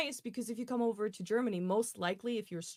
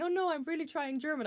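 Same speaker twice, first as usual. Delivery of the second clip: higher-pitched mock insistence; lightly acted, high-voiced objection; imitated earnest protest; 9 semitones higher than usual. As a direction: imitate an eager learner pleading. Retold learner insisting on speaking German.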